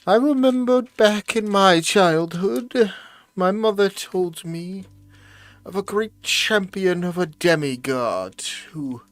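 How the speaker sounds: put-on kindly old grandmother telling a tale; old lady voice. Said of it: "gotta warm up the old lady voice".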